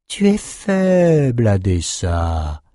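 slow, grinding mockery from a skull; severed head sneering at the warrior; grating taunt. A narrator's reading. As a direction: grind out the insult slowly. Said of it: "grinça Maxime".

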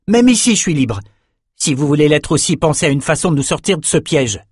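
high, emphatic opening, then urging; rally reluctant companions with conviction; defiant young fighter. A narrator's reading. Bannon rallies the captives.

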